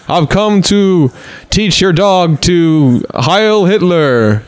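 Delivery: put-on slow Dracula-style Transylvanian accent. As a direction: drag it out in a Transylvanian vampire accent. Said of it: "That's a great Transylvanian accent".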